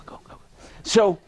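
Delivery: usual speaking voice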